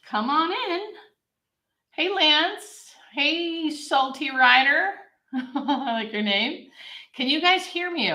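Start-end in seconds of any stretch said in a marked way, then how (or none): none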